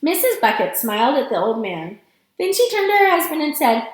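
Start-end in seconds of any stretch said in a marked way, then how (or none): none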